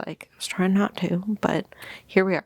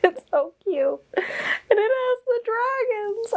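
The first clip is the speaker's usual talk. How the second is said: very high, slow gushing; tearful gush. Real, not acted. Seeing the dragon edges, high tearful gushing. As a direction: squeal over the dragons.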